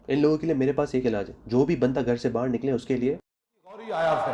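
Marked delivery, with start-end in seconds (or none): 3.7-4.3 a high, shouted meme-style threat; mock menacing shout. Threatening people who leave home, in a mock menacing shout. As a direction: yell it like a cartoon villain arriving.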